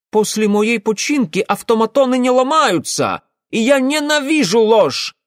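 put-on high, loud indignant young man; indignant shout. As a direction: burst out in outrage. Ingvar indignant outburst.

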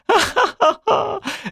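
high manic laughter; ringing loud laugh. Acted. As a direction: cackle in wild joy. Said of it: "звонко и громко рассмеялась она".

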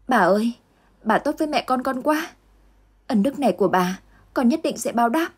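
put-on overflowing thanks to a kind old woman; young mother thanking her benefactor, touched; grateful young mother.

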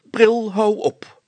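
high loud slow snap; irritated interruption. A narrator's reading. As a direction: cut him off, exasperated. Mayor interrupts Brilstra sharply. (geïrriteerd onderbreken)

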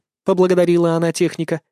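usual speaking voice

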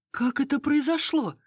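high, stunned question; forced out, shocked. A narrator's reading. Narrator hears of prince's murder, choked with shock. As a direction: struggle to get the question out. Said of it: "с трудом выдавила я".

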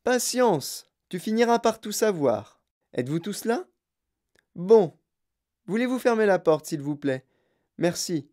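high, lively host voice; Wonka reassuring. Acted. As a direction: fob the child off, then bustle the group along.

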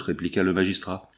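ordinary delivery, nothing marked

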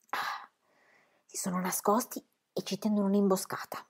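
very soft, secretive murmur; wary hush. A narrator's reading. Pippi suspects a cannibal ambush, hushed. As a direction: keep it down, enemies may be hiding.

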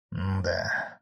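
usual speaking voice

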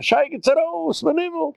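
high-pitched mock cry of alarm; retold scream. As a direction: cry out like the whole crowd yelling get out. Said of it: "ich doch jeder schreien".

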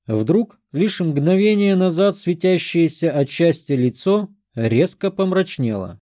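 ordinary delivery, nothing marked

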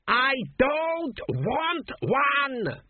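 very high, slow scream of protest; the ghost king shrieking his refusal. A narrator's reading. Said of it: "screamed the king".

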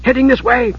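high-pitched urgent warning; hissed warning. A narrator's reading. Usha warning of knights approaching, urgent hiss. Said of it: "Usha hissed".

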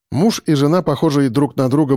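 ordinary delivery, nothing marked

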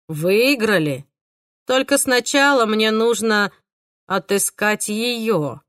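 slow, eerie killer voice; drawn-out and unsettling; creepy killer voice. Acted. Killer's lines.

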